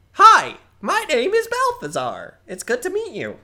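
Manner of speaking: high, loud and chatty goblin voice; young goblin sorcerer introducing himself; eager young goblin. Acted. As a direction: ramble on like a nervous young goblin. Goblin Balthazar voiced throughout.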